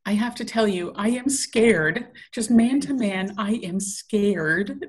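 scared stretched slowly with a rising tune; drawn-out scared. Retold or imitated. Quoting Chilton's line to a friend, slow rising scared. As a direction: linger on each word, ending scared up high. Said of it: "the way he says scared".

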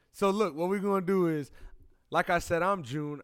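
loud podcast host intro. Acted. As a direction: announce it like opening the show. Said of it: "I'm using my intro voice".

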